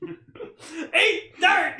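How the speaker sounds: very high laughing cartoon shout; Earthworm Jim. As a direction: shriek it through laughter. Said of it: "Dan Castellaneta's Earthworm Jim".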